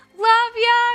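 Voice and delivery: high, loud cheery farewell; chirpy sign-off; sing-song goodbye. Real, not acted. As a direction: toss it off brightly high. Signing off cheerily.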